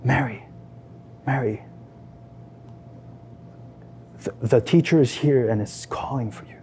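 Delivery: low, soft murmur close to the ear; understated whisper. A narrator's reading. Martha kneels beside her sister to call her, understated whisper. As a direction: break it gently, low and hushed. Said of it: "she whispers by your ear".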